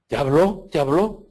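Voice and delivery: put-on a curious child bursting to know; eager little girl. Priest voicing the curious girl pressing him eagerly.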